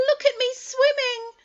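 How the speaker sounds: a tiny joey showing off; squeaky Roo. Acted. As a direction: Roo calling from the water, squeaky and proud. Roo's voice held.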